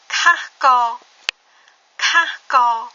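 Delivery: teacher models Kahko for students to repeat; Khmer word model. Shown as a demonstration. Teacher to learners, modelling Kahko clearly. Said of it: "on a l'avant-dernier mot".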